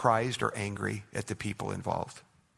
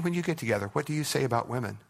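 ordinary talk, nothing marked

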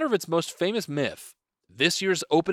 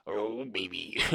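put-on low, lecherous "oh baby"; sleazy old man; 8 semitones lower, 10 dB quieter, slower than usual.

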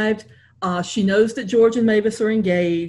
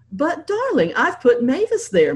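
a touch of feigned protest, raised pitch; mock protest; 7 semitones higher than usual. Mom pretending to protest to Henry over seating, lightly raised. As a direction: protest sweetly, only pretending. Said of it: "Mom protested".

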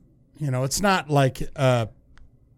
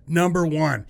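announcer voice; 5 semitones higher than usual. Pretend. Imagined podcast announcement.

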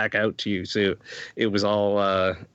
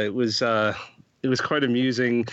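ordinary talk

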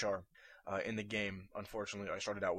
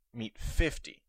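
usual speaking voice

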